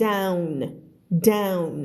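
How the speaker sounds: "down" closing firmly on an N; careful "down" with audible final N; sounded final N. Shown as a demonstration. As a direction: let the N ring at the end of "down". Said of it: "แต่ถ้ามี N".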